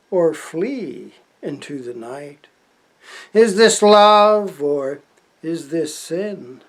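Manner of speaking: slow, declaimed verse with long pauses; poetry recital. Acted. Poet reads his chapbook to the camera, slow declaimed poetry. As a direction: ring out each question loudly, then ease down. Reads own poem aloud.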